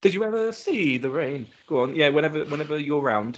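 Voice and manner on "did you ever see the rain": normal talking voice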